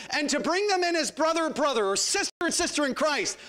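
high, driving sermon list; building preaching. Real, not acted. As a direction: build phrase by phrase toward a peak. Preacher builds through a long list.

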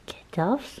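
hushed urgent whisper to the cat. Real, not acted. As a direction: whisper it so as not to startle. Hushing cat during intro.

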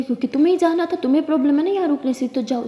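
shouting back. Acted. Jess shouting back at Sara, fast and loud. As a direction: shout her down quickly.

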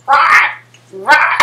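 loud squawking pterodactyl cry; pterodactyl shriek. Pretend. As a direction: screech like a flying dinosaur. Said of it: "You do a pterodactyl noise".

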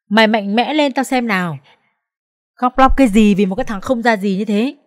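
the friend Chi snapping at her to stop crying; friend's scolding voice. Acted.

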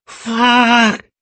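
high, loud, drawn-out yelp; pained cry. A narrator's reading. Cries out in pain.

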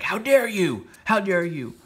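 high, loud outraged retort; completely indignant. Acted. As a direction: act out an affronted lord taking offence. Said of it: "Dreaming gets completely indignant".

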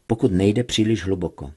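plain reading voice

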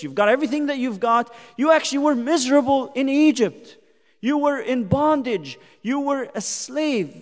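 fervent pulpit declaration; preaching at full force. Acted. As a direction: drive the point home. Preaching loud again.

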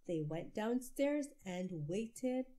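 ordinary delivery

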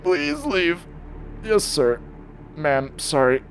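loud, high frightened babble; panicked pleading. Real, not acted. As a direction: beg the monster to go away. Monster finds him, panicked pleading.

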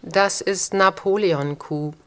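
usual speaking voice

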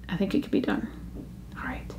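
unvoiced, soft aside; whisper. Real, not acted. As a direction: whisper it to yourself.

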